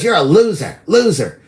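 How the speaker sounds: imitating her jeering; nasty taunt. A retold woman jeering him, mock high taunt. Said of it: "she got all real nasty".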